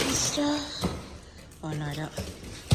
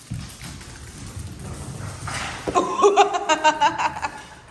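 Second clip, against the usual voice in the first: laugh; 7 semitones higher than usual. Real, not acted. Long laugh at dogs.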